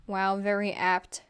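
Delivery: ordinary delivery, nothing marked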